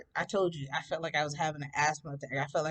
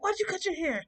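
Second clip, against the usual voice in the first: mom's voice; 13 semitones higher than usual. Retold or imitated. Mom questioning daughter.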